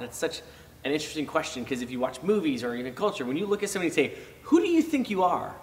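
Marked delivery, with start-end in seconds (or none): 4.4-5.5 judgy challenge. Retold or imitated. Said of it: "It's always pretty judgy, and it's always pretty aggressive".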